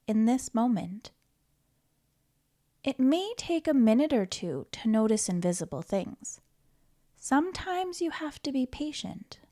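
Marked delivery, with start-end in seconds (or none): none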